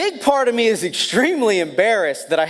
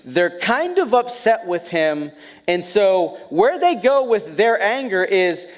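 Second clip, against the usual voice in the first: ordinary talk, nothing marked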